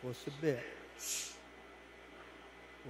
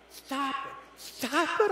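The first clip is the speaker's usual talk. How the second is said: high pleading cry, rising in volume; 11 semitones higher, 6 dB louder, much slower than usual. Acted. Teacup crying out to the master over the fumes. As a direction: plead with him to stop, the fumes choking you. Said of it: "I cried".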